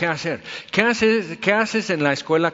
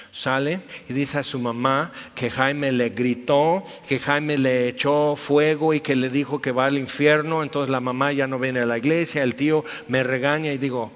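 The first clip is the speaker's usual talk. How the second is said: ordinary delivery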